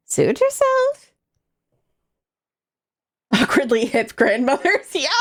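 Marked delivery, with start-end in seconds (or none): 0.1-1.1 put-on high, slow old lady voice; grandma voice. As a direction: drawl it like a sassy grandma. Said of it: "I was doing grandma voice".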